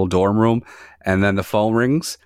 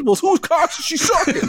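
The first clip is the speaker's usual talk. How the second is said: much higher mock yelling. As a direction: yell it at a child in bed. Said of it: "picture yelling at a little twelve-year-old girl".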